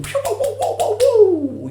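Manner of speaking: very high, loud wow-wow siren; whooping celebration noise. Acted. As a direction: whoop it up in falsetto. Imitated noise celebrating the money.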